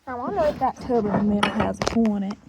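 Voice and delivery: ordinary delivery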